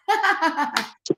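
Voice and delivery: high bright burst of laughter; laughing at her new motto; real laugh. Real, not acted. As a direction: laugh at your own motto.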